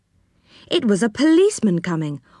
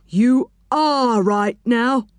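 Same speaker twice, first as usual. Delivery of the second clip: slow, drawn-out officious reply; slow, smug refusal in character; stern policeman; 3 semitones higher, much slower than usual. A narrator's reading. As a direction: stretch each word in a put-on official voice. Stern policeman refuses Joe's plea.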